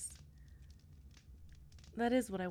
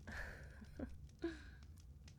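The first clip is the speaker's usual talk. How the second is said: soft giggle; 7 semitones higher, 13 dB quieter than usual. Acted. Flustered laugh.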